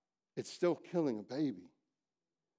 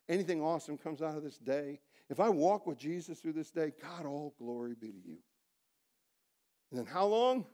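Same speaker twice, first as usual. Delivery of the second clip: ordinary delivery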